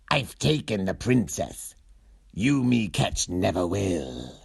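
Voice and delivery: a hint of sneaky taunt; mysterious note writer. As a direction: read the note like a sly kidnapper. Kidnapper's note read aloud.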